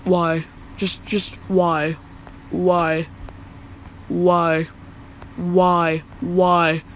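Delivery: monotone nagging, one word over and over; annoying repeated questioning. Retold or imitated. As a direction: keep asking just to annoy, with evenly spaced repeats. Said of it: "I'm repeatedly asking her why, because I'm trying to annoy her".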